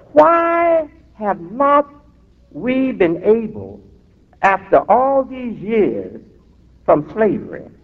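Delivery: high, drawn-out opening question; slow rhetorical question. Real, not acted. Minister asking the congregation why, slow and drawn out. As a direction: stretch each word out to the crowd.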